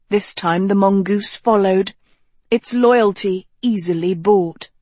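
plain reading voice

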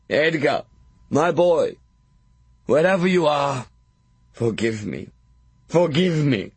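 dying father begging forgiveness; pleading dying father. A narrator's reading. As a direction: beg forgiveness with the last breath. Dying father begs son's forgiveness.

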